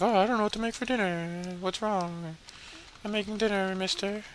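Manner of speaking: high, soft singsong put-on voice; character fussing over dinner. Game character fretting over dinner, high mock voice. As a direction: lightly mimic a dinner-maker up high.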